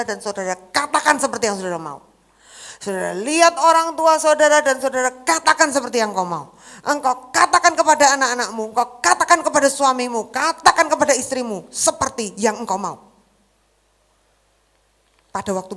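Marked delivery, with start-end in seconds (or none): 2.8-13.0 loud, driving sermon delivery; louder preacher's exhortation; preaching at full force. Acted. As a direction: hammer each command home to the congregation.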